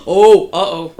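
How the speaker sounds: ordinary delivery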